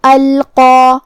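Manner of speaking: القا with a thick, heavy qaf and long alif. Shown as a demonstration. Teacher shows heavy qaf and long alif. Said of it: "دونوں حرف کو بھاری آواز میں".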